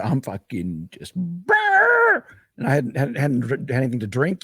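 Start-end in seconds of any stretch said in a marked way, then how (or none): 0.0-2.2 stoned brrr. Retold or imitated. Stoned, describing being baked.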